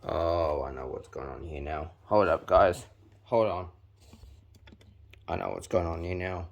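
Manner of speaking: ordinary talk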